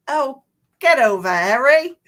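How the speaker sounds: loud put-on British voice; British accent. Host showing another kind of British accent, loud mock-British. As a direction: call it out in hammed-up British. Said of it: "There's all kinds of British accents".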